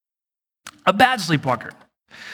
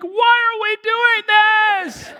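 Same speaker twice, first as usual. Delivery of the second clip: high, drawn-out mock wail; exaggerated high-pitched sleepy-helper protest; imitating bewildered wife; slower than usual. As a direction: cry it out slowly as a confused wife. Voicing his bewildered wife in story.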